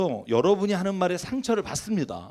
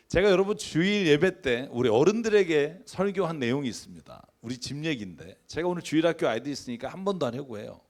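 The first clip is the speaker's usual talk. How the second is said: ordinary delivery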